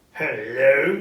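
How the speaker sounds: loud gruff greeting, playing at old temper; loud mock-angry hello to a late clerk. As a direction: fake the old stern Scrooge. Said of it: "in his old angry voice".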